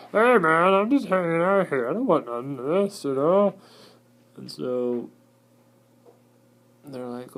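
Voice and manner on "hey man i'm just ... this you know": high, loud, pleading little spider; hammed-up timid spider; nervous cellar spider. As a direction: squeak out that you want no trouble. Cellar spider voiced, laughing lead-in.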